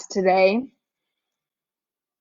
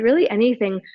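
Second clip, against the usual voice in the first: normal talking voice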